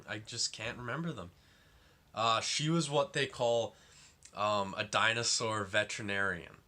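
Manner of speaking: ordinary talk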